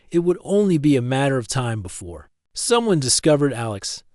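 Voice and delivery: usual speaking voice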